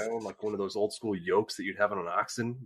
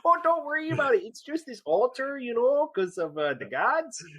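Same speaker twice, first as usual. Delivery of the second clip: much higher, hammed-up falsetto, slow and casual; mock innocent reply; 3 dB louder, slower than usual. Husband answering the neighbour Bob in a squeaky, hammed-up innocent voice. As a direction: play it dumb and breezy in a squeaky voice.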